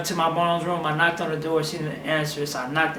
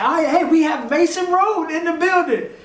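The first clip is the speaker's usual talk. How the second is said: high-pitched excited announcer imitation; mock a radio host hyping the arrival; radio host hype; 11 semitones higher, 4 dB louder than usual. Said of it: "the radio people and everybody was like".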